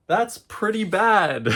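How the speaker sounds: laughing it off, embarrassed. Real, not acted. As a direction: chuckle through the words. Laughs at own indecision.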